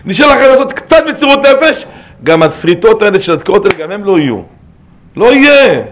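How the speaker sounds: loud, high-pitched sermon delivery; fervent preaching. Real, not acted. Preaches at full force. (דרשה נלהבת בקול רם)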